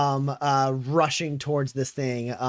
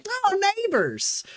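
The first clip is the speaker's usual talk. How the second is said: put-on very high, slow cooing old lady; delighted old woman; much slower than usual. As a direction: coo it out like a thrilled grandma. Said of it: "She goes".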